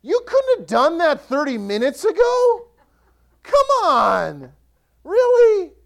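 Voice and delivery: laughing, high and loud incredulous complaint; mock-outraged whine at the late miracle; half-laughing, are you kidding me; exasperated disbelief. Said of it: "Can you imagine the look on their face".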